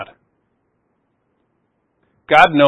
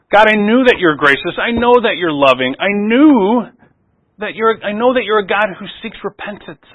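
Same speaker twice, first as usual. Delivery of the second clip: Jonah breaking down; 6 semitones higher than usual. Retold or imitated. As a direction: pour out Jonah's bitter protest, voice climbing. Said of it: "finally breaks down and says to the Lord".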